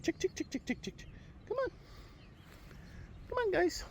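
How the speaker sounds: soft high ticking to chicks; hushed high coaxing call; calling chickens. Real, not acted.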